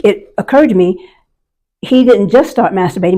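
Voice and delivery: ordinary talk, nothing marked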